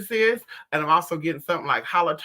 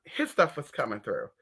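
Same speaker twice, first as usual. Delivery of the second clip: usual speaking voice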